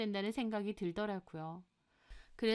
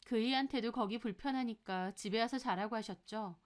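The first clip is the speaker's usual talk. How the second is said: usual speaking voice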